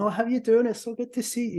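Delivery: high, sing-song friendly greeting; voice pitch going up. Acted. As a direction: put on a high best-friend hello. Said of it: "Your voice pitch may go up".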